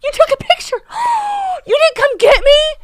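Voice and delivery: high, gasping, incredulous squeal; shocked protest. Real, not acted. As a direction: squeal the reproach with a big gasp. Learns she was left out.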